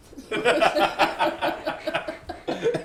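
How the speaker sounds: high-pitched cackle like a unicorn; long, high wheezing laugh. Real, not acted. Friend laughs at his own intro, high unicorn laugh. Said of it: "that was your unicorn voice".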